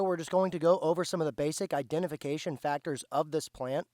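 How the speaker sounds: ordinary talk, nothing marked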